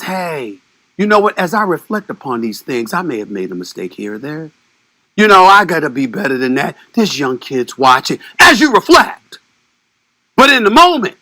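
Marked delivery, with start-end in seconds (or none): 0.0-8.2 a hammed-up soul-searching athlete, hushed and low; mock-reflective Kyrie. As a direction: play the calm penitent after a deep breath. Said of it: "sits back, takes a deep breath, pauses, and says".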